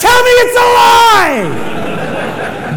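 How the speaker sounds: high, loud shriek of disbelief; hysterical scream. Retold or imitated. Wife shrieking at the ultrasound technician, hysterical. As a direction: scream it out and drag out the demand. Gentle wife reacting to twins news.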